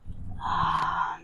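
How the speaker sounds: whispery soft sigh; audible exhale. Shown as a demonstration. Guide breathes with the stretch, whispered sigh.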